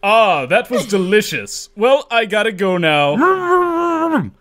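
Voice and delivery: pompous Ludwig voice; satisfied Ludwig. Acted. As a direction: savor it, then drawl the exit. Ludwig after eating chocolate.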